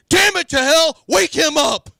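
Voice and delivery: put-on high, loud furious yell at the kids; dad's angry shout. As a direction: blast it like an outraged dad in the driver's seat. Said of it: "my dad goes".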